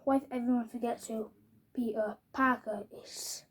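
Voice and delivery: softly whispered question; whispered spell. Acted. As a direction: hush it like casting a spell. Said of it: "He says".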